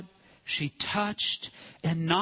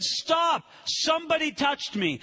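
shouted halt; 7 semitones higher than usual. Acted. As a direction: stop the crowd with a shout. Jesus shouts to the crowd.

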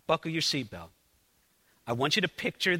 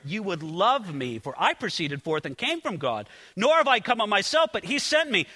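raised, ringing preacher's reading of the Gospel; lifted pulpit reading voice; scripture reading; 5 semitones higher than usual. Acted. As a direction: proclaim the verses to the congregation. Reads scripture passage aloud.

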